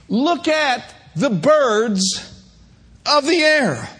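slow, plain-spoken paraphrase; slow easy retelling of the verse. Shown as a demonstration. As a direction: spell it out slowly for the flock. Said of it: "Another way is this, to say it is this".

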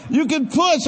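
high, loud doctor's command; shouted order. Retold or imitated. Doctor to mother in labor, shouted order. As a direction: act out an urgent delivery-room call.

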